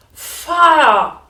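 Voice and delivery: piercing high alarm shout; hugely loud, high storybook shriek; old woman yelling. A narrator's reading. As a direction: scream it at full blast as a frantic grandma. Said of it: "the old woman yelled".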